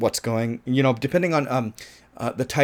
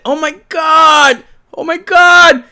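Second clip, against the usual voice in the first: high, loud, slow oh my God; exasperated disbelief; 11 dB louder, slower than usual. Real, not acted. Reviewer reacting to the cocaine flushed down the toilet, high and loud. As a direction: groan it out in disbelief.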